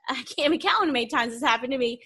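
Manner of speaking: quick, laughing disbelief. Real, not acted. Laughing recalling many times.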